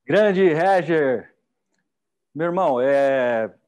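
big warm hail to a friend; hearty loud greeting. Real, not acted. Host hailing his guest after the talk, loud and slow.

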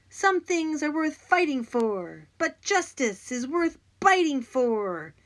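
high, loud, slow heroic declaration; boy yelling a hero's catchphrase; yelled hero quote. Acted. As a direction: proclaim it like a movie hero. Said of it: "I yelled again".

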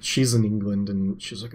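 ordinary delivery

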